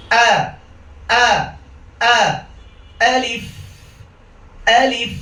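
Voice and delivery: high, hard "আ" from the throat, then "আলিফ"; alif recitation drill. Shown as a demonstration. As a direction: snap each "আ", then hold the f of "আলিফ". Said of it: "আমরা আবার বলছি".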